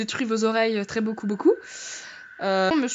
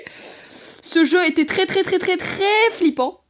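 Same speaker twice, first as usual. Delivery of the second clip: "très" repeated, slow and pitched up; emphatic repetition; 5 semitones higher, much slower than usual. Real, not acted. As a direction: draw out each "très" with rising intensity. Stresses how scary it was.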